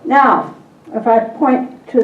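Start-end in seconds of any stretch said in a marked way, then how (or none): none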